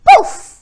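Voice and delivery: high, loud magic poof. A narrator's reading. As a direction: pop it out like a spell going off. Magic transformation sound.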